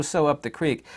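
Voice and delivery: normal talking voice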